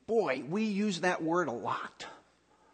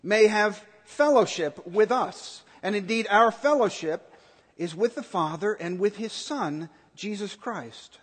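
scripture reading; 3 dB louder than usual. Acted. Pastor reading scripture to the congregation, solemn. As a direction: read the passage with weight.

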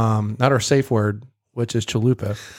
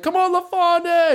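high excited cheer; root her on, high-pitched. Retold or imitated. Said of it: "I'm like".

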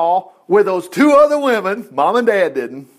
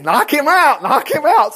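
higher, louder urging shout; excited urging; 9 semitones higher, 4 dB louder than usual. Retold or imitated. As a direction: urge her on to shoot now. Urging daughter over phone to shoot.

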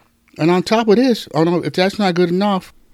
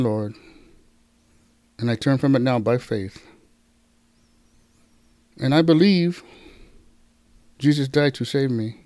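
prayer; 2 semitones lower than usual. Acted. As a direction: lead the prayer gently, phrase by phrase. Leads salvation prayer.

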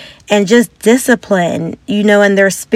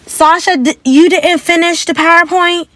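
higher, sharp accusing question; higher accusing question to a coworker; accusatory tone; 9 semitones higher than usual. Shown as a demonstration. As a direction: pin the blame on her. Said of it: "Instead of saying".

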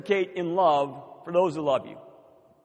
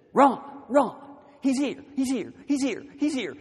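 high, giddy repeated announcement; excited wife; 7 semitones higher, 3 dB louder than usual. Retold or imitated. Wife running up to her pastor, excited. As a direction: imitate her bursting with the news. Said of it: "she is dancing and clicking her heels".

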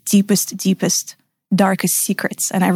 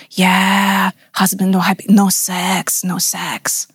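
Balinese masseur; slower than usual. Retold or imitated. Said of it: "He goes".

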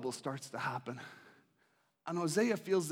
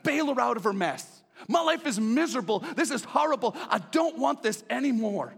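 loud, higher, exasperated complaining; Hosea's frustrated rant; 6 semitones higher, 4 dB louder than usual. Acted. Hosea complains to God about his wife's mess, loud and exasperated. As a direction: vent every grievance at God, fed up. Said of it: "Hosea's got this life and vision".

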